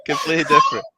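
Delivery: amused, laughing as the words come out. Real, not acted. Teasing a co-host about his English, laughing.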